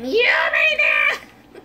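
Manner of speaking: high, loud drawn-out scream; over-the-top stretched-out yell. Shown as a demonstration. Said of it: "I just scream".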